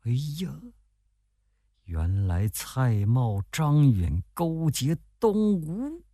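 slow, hushed realisation climbing in pitch; shocked thought. A narrator's reading. Spy discovering traitors in a secret letter, shocked inner voice. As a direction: think aloud, stretching each name in dismay. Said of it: "蒋干想".

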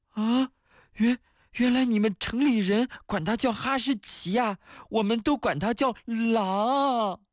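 high, put-on child voice, stammering; anxious high voice, dragging out the last word; nervous little friend. Village kid warning city friend about a wolf, nervous. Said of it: "十分紧张地".

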